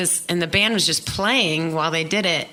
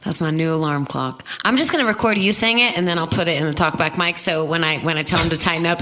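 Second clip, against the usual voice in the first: ordinary talk, nothing marked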